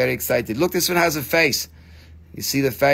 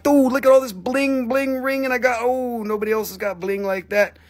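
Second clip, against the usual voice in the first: show-off friend; 8 semitones higher than usual. Pretend. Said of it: "you'd be like".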